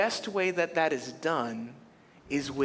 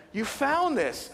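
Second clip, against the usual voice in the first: thrilled; 9 semitones higher, slower than usual. Retold or imitated. Friend thrilled by gift.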